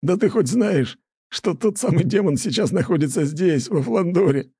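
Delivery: high, gleeful rough fellow; a hint of a shaggy drunk; rough neighbour voice. A narrator's reading. Laughing neighbour reveals news. (весёлый грубоватый сосед)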